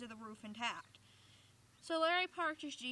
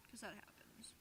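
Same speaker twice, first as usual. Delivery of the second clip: unvoiced, hushed whisper; whispered aside; 3 semitones lower, 17 dB quieter than usual. Real, not acted. Reader's joking aside to the dog, whisper. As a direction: confide it in a whisper. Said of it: "aside whispered".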